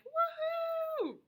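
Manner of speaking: very high, long "woohoo"; sky-high celebratory hoot; excited whoop. Shown as a demonstration. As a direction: whoop in a high sustained cheer. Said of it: "I'm like".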